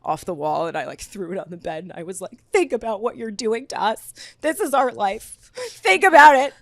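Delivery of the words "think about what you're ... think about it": mock dramatic plea, climbing higher to a loud finish. Girlfriend begging boyfriend to rethink the breakup, dramatic. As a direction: plead theatrically, building to an outburst. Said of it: "it was the right time to be dramatic".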